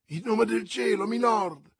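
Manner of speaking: urgent, high-pitched cry; exclaiming. A narrator's reading. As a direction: cry out to stop a sword fight. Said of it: "esclamò Madame Bonacieux gettandosi". (esclamazione acuta e concitata)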